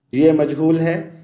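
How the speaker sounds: usual speaking voice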